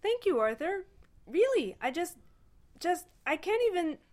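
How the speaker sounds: high, halting gush of thanks; gush, too moved to finish; overwhelmed gratitude. A narrator's reading. Ford thanks Arthur for the gift, overwhelmed.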